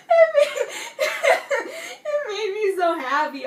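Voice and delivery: words squeezed through giggles; laughing happily. Real, not acted. Fan delighted that Ronan calls Gansey dad, laughing.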